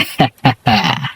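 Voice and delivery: short soft chuckle; a quiet contented laugh; soft laugh to round off the thought; satisfied laugh. Acted. Laughs after predicting good business.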